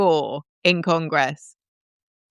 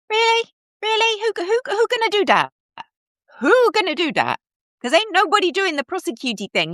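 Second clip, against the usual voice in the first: much higher, scoffing incredulous voice; mock disbelief; 13 semitones higher, faster than usual. Host mocking the congressman's threat, scoffing in a much higher voice. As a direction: scoff at the idea nobody will prosecute.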